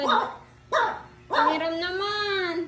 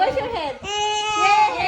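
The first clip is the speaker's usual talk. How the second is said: delighted cheering; 5 semitones higher, 5 dB louder, much slower than usual. Real, not acted. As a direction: cheer a little one's trick, high and loud. Cheering child doing trick.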